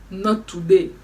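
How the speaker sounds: a firm, loud "Not today", its first word hit hard; loud flat refusal, the first take. Retold or imitated. Refusing at the toilet door.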